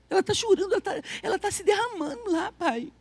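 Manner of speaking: lightly voiced anxious daughter; worried child, retold. Daughter telling her father that mother is crying, retold with hushed worry.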